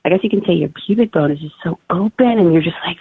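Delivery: ordinary delivery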